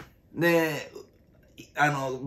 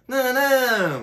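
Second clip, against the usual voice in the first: a lightly higher, slow Pokémon cry; Geodude cry; 10 semitones higher, 5 dB louder, much slower than usual. Retold or imitated. Comedian comparing his shout to a Pokémon cry, slow and high. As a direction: mimic the little rock monster's call. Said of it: "石つぶての".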